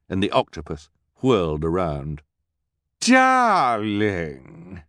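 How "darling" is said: loud, drawn-out glamorous "Darling"; villainess calling out. A narrator's reading. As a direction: sweep the word out like a diva. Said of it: "it cried".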